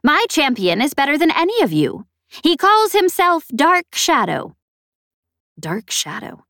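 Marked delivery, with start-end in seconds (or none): 0.0-4.6 high, loud, boastful snappish alien. A narrator's reading. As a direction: brag about your champion, snapping. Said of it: "snappish and vaguely familiar".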